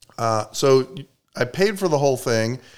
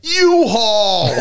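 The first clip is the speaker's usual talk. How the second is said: disgusted shout; 8 semitones higher, 5 dB louder than usual. Real, not acted. Names hated company.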